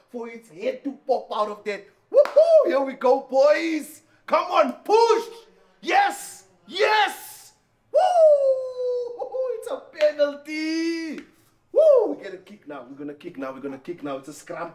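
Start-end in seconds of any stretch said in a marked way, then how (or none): 2.1-6.4 high, loud rallying shout; real cheering. Real, not acted. Cheering on the scrum. 6.7-12.1 high, loud whoops; real triumphant cheering. Real, not acted. Celebrating a won penalty.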